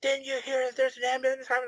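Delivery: high, quick mock yelling; imitated angry yell. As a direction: imitate a livid guard scolding a driver. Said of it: "he just yells at me".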